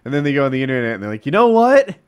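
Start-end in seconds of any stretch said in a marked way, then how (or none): 1.2-2.0 high, loud announcement; mock sudden realization. Viewers who went on the internet, loud mock realization. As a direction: mimic viewers loudly changing their minds. Voicing viewers turning after reading online.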